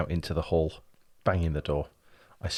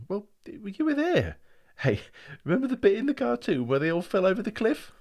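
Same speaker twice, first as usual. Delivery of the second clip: much higher, louder, thrilled; excited gushing; 14 semitones higher, 6 dB louder than usual. Acted. Brian reliving the outing to his brother, excitedly. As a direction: gush with delight.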